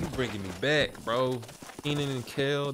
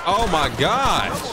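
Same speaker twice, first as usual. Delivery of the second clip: drawn out, much louder and higher oh my gosh; shocked exclamation; 9 semitones higher, 9 dB louder, much slower than usual. Real, not acted. Streamer reacting to chat with loud, slow shock. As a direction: stretch it out in loud disbelief.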